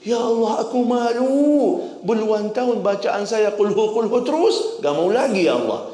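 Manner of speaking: a light touch of pleading shame; ashamed prayer. Acted. Voices a sincere ashamed prayer.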